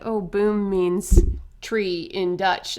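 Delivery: slowly spelling it out, retold; patient slow correction; mock slow explanation to a foreigner; slow explaining. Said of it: "And they're like".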